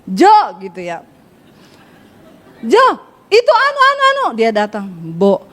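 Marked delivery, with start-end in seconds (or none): 2.6-4.3 high, rapid stammered call; fast, high-toned Sulawesi. Acted. Sulawesi wife calling her husband, fast and high. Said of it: "cepet, nadanya tinggi, nggak bisa berhenti". 4.9-5.4 low, drawn-out Javanese pleading; slow everything down, pitched low; slow Solo husband. Acted. Solo husband asking his wife to speak gently, slow and low. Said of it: "Orang Solo kalau ngomong pelan, halus".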